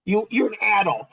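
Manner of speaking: ordinary delivery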